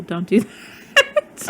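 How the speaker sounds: laughing through "Don't do that"; laughing warning. Real, not acted. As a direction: warn while laughing.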